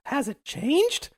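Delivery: high, drawn-out bug question; second cartoon bug. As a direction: ask it slowly in a tiny insect voice. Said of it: "the other bug says".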